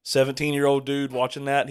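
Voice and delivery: normal talking voice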